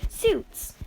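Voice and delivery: All Might finally announces the suits, high and emphatic; announcing shout. Retold or imitated. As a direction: fling out "Suits!" like a big reveal.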